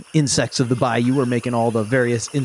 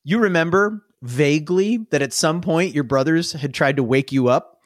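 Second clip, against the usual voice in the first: usual speaking voice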